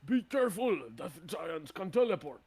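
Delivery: loud, high-pitched alarm; yelled warning. Acted. Giant warning others about death giants, yelled warning. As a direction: yell to warn your comrades. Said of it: "As one of them will yell".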